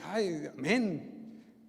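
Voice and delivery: a drawn-out, very slow sighing amen as the apostle; lightly voiced apostle's amen; imitating Paul. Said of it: "hasta Pablo dice".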